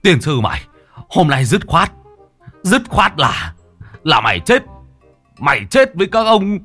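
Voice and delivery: mock-angry village uncle; furious relatives. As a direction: threaten the bomb-bringer with a beating. Angry relatives grab Đồng.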